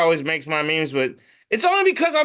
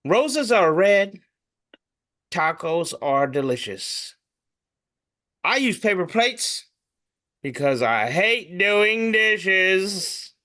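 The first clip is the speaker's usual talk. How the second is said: poem recital; slower than usual. Acted. Reads a meme poem.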